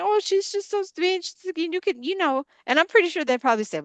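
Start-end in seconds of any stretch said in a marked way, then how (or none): 0.0-2.0 high, mock-sweet voice of gossipers, much higher; mimicking people who call her naive in a high sweet voice. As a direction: sneer at them in a sugary high voice. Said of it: "that's the narrative that people spin".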